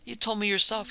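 usual speaking voice

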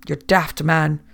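ordinary delivery

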